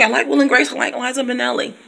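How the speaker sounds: usual speaking voice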